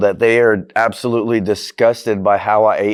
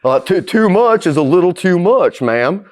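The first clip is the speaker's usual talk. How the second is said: mock Doctor Phil; 8 semitones higher, 4 dB louder than usual. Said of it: "it sounds like a Doctor Phil".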